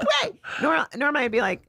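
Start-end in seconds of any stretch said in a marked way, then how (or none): 0.0-0.4 very high, worked-up protest; outraged squeal. Retold or imitated. Comic retelling being bumped, outraged high squeal. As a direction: squeal it in exasperated fury.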